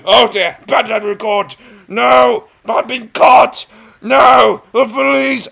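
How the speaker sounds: high, loud panicked cry; mock panic. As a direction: yell as if caught by police.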